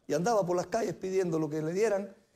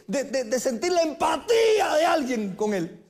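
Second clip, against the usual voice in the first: slow, rising fervent shout; preaching climax; 4 semitones higher, slower than usual. Real, not acted. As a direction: build to a full preacher's cry.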